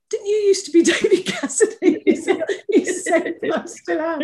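high, laughter-filled retelling; laughing through words. Real, not acted. Quoting the singer's joke about his fame, laughing. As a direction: barely get the punchline out for laughing.

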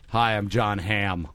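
low, slow celebrity greeting; John Hamm. Acted. John Hamm introduced as podcast guest, low and slow. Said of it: "Welcome to today's guest".

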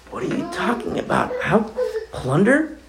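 high, soft voice of astonished disbelief; a stunned, half-laughing what are you talking about; incredulous disbelief. Retold or imitated. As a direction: play Moses who can't believe his ears. Moses reacting to God's promise.

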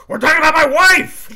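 finally boil over and yell it; exploding shout. Retold or imitated. Ford's character finally snapping at a shrugging Frenchman, exploding shout. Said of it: "him just finally being like".

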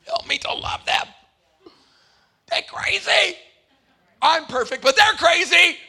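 loud, high, hammed-up pleading prayer; pleading prayer pitched high and loud, then dropping to a grumble; mock self-righteous prayer. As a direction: cry out to God like a self-righteous saint. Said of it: "we have to pray".

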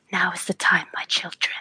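whispered command to her brood; hushed, voiceless whisper; conspiratorial whisper. Acted. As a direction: whisper it, as if summoning little minions. Character secretly commands her creatures.

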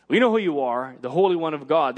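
normal talking voice

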